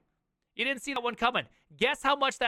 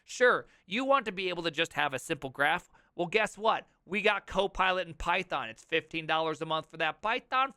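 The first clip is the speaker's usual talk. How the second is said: normal talking voice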